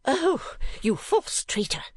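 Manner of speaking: high, drawn-out theatrical outrage; high-pitched melodramatic accusation; indignant cry. A narrator's reading. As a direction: wail the accusation as a wronged wife. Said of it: "cried the lady".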